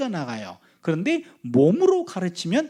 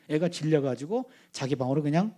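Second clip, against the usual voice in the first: normal talking voice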